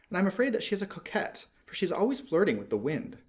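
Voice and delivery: a small bird, peeved and pouting; little swallow. A narrator's reading. Swallow voiced in story.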